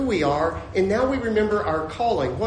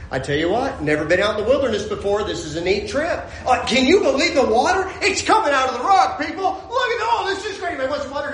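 giddy wide-eyed wonder, climbing higher; acted delighted traveler; 7 semitones higher, 4 dB louder than usual. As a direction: marvel out loud at a miracle, hammed-up. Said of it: "Well, they should have gone".

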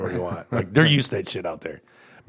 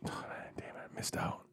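regretful whispered aside; 22 dB quieter than usual. Real, not acted. Man to friends, whispering his regret at a missed chance. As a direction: breathe it out under your breath, rueful.

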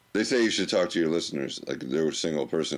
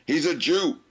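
high-pitched mock yell of an old uncle; imitated scream; 12 semitones higher, 3 dB louder than usual. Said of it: "he would just scream".

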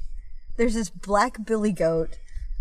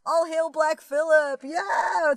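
high-pitched drawn-out mock crowd cheer; imitating a whooping karaoke crowd; rallying cheer; 6 semitones higher, slower than usual. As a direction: hail your hero like a fan.

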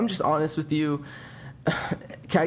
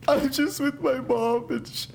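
put-on teary teen whine; 10 semitones higher, slower than usual. Son character sobbing about mom.

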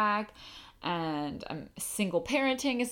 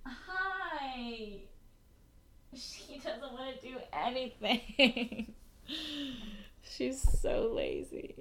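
higher, slow, doting voice; puppy talk; 6 semitones higher, much slower than usual. Real, not acted. Greeting her puppy under the couch, fondly. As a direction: coo at the lazy puppy.

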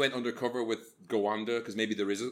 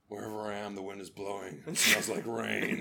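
mock mystic character; 5 semitones lower, 4 dB quieter than usual. Imitating the brooding hero, soft and dreamy. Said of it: "And he's like".

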